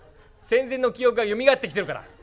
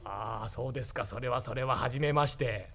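senile grandpa; 8 semitones lower, 9 dB quieter than usual. Acted. Senile grandpa greets daughter-in-law.

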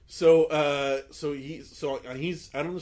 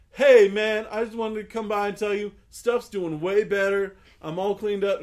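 loud, raised long-distance call; 7 semitones higher, 9 dB louder than usual. Retold or imitated. Man in alley calls up to storyteller in garage, loud retold holler. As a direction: project it up to a high floor.

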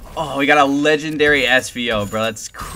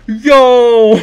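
high, loud, drawn-out cheer; thrilled shout; 10 semitones higher, 11 dB louder than usual. Real, not acted. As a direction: burst out in amazed delight. Reacting to legendary pull.

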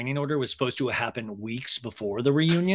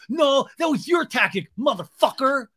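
high, loud yelled comeback; hammed-up angry shout; mock shout; 12 semitones higher, 6 dB louder than usual. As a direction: yell it back at her. Acted quarrel shout.

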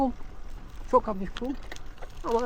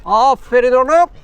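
higher, louder, drawn-out praise for a good dog; 5 semitones higher, 8 dB louder, slower than usual. Real, not acted. Cyclist loudly praising the dog running beside him, slow and high. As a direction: cheer the dog on like a proud owner.

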